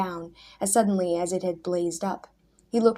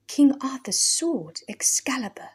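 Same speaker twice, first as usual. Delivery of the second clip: in awe; 6 semitones higher, 3 dB louder than usual. A narrator's reading. Hugh gazing at the sword in awe. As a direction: breathe it out in wonder. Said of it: "exclaimed Hugh in awe".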